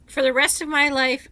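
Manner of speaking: ordinary talk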